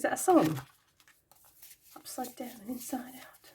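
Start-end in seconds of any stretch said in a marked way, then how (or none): 2.0-3.5 hushed whispered echo. Real, not acted. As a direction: whisper it back to yourself. Whispers the phrase back.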